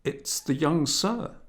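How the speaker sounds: high, startled exclamation of the maid; a surprised maid blurting it out; a maid caught off guard by the boy; exclaiming. A narrator's reading. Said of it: "she exclaimed".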